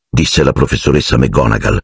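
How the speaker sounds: ordinary delivery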